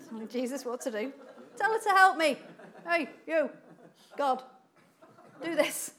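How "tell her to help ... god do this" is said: loud, higher, commanding Martha demanding help; bossy Martha. Acted. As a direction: boss Jesus around like a pushy sister, half laughing. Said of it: "She's a bit bossy, wasn't she".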